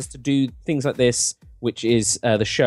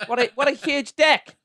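put-on New Zealand accent; 10 semitones higher than usual. Wife admiring the actor's deck, gushing New Zealand accent. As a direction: gush about it in a giggling New Zealand accent. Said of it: "she says it in the New Zealand".

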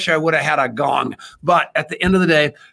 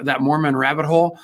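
usual speaking voice

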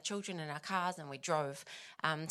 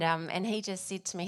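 ordinary delivery

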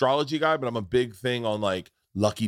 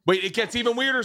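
hyped to reveal more; excited; 12 semitones higher, faster than usual. Real, not acted. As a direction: pitch jumps up, eager buildup. Building excitement over coincidences.

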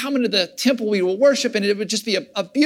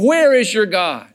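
a mild jeering voice, a touch louder; mocking taunt; 4 dB louder than usual. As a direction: throw the question back as a taunt. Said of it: "others are mocking me".

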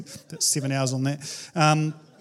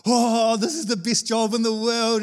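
elated; 5 semitones higher, 3 dB louder than usual. Retold or imitated. Pastor's high after good service.

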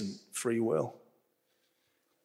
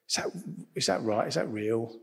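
doubtful inner voice; 4 semitones lower, 9 dB quieter, faster than usual. Retold or imitated. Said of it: "part of me is like".

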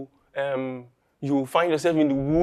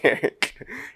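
nervous laugh. Acted. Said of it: "they'll just start laughing".

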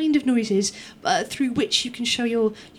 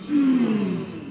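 group hmm; 3 dB louder than usual. Shown as a demonstration. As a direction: hum thoughtfully in unison. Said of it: "we're all gonna hmm together".

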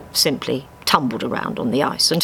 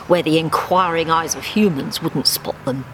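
plain reading voice

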